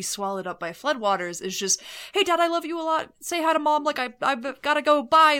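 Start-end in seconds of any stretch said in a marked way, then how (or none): 2.1-5.4 quick goodbye to dad. Pretend. Imagined hurried call to dad.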